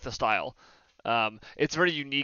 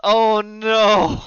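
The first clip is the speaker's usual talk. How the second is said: mock dread; 7 semitones higher, 8 dB louder, much slower than usual. Real, not acted. Dreading news, laughing.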